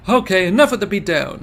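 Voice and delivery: loud intervening call. Acted. Minorikawa stopping the beating.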